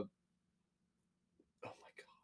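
breathy whispered oh my god. Real, not acted. Searching for a word, whispering. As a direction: whisper it to yourself.

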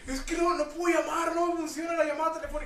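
high shrieking customer lady; mock angry lady. Retelling an upset lady caller, high mock shriek. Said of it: "una señora me dijo".

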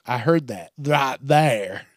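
ordinary delivery

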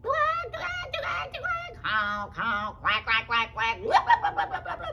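loud squawks, honks and gobbles; animal commotion. Acted. Fox hears the runners, a squawking commotion. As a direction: run all the bird noises together loudly. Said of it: "he heard all that commotion".

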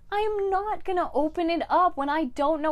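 high, exasperated protest; acted-out outraged objection; indignant refusal.

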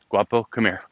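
calling the dog over. Real, not acted. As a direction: call out to your dog.

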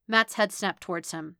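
plain reading voice

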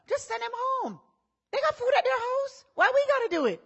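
high whining protest; whiny disciple. Retold or imitated. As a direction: whine it up high like a reluctant helper.

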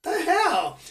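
high, exasperated the hell; mock outrage. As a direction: play the annoyed crowd in line. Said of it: "everybody behind us is like".

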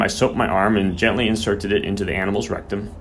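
usual speaking voice

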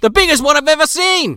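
high mock-amazed Australian host; Steve Irwin. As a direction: marvel at the beast in an Australian accent. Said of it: "he starts talking about it like".

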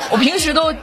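ordinary talk

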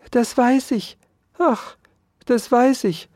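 high, plaintive mouse voice; meek little mouse. A narrator's reading. Mouse humbly agrees with the threatening Dauerspeck, meekly. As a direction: squeak it humbly and woefully.

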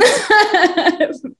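high-pitched burst of laughter; real laugh. Real, not acted. Panelist amused by her own answer, laughing.

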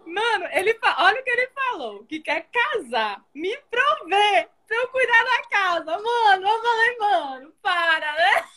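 high, crying-laughing outrage; can barely get it out for laughing; incredulous, voice cracking with laughter; laughing disbelief. Real, not acted. Laughing disbelief retelling his offer.

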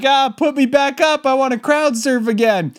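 high, urgent begging; panicked plea. Retold or imitated. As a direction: beg to be lifted up.

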